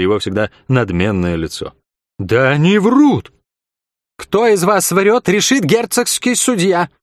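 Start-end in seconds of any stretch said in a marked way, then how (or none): 2.2-3.3 higher, louder and slow protest; indignant outcry. A narrator's reading. Innkeeper accused of murder, indignant slow outcry. 4.2-6.9 raised voice. A narrator's reading. Young commander silencing the quarrel, raised high voice. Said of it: "повысил и без того высокий голос".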